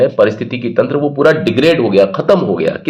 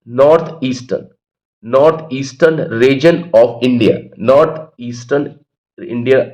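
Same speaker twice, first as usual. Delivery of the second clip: slow dictation; much slower than usual. Shown as a demonstration. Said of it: "teacher dictating the line".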